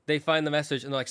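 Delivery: ordinary talk, nothing marked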